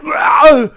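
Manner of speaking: high startled cry; startled yell. Real, not acted. Streamer seeing the chat is in sync, startled yell. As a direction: yell out in sudden disbelief.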